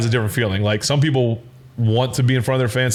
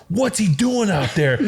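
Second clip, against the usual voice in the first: higher, drawn-out incredulous question; exasperated demand; 7 semitones higher, slower than usual. Retold or imitated. Head coach asking Charlie about the quarterback, exasperated. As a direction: imitate a baffled coach demanding answers.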